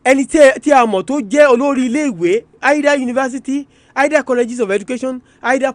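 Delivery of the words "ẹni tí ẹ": ordinary talk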